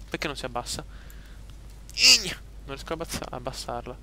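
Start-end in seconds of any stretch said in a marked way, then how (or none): 1.9-2.4 high sharp call. Real, not acted. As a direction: shout the name out. Calling out to companion.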